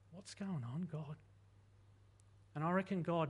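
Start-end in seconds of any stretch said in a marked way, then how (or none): 0.1-1.2 soft, hushed questioning plea; quiet plea. Acted. Job asking God quietly in his suffering. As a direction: ask God softly in confusion. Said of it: "Job asks".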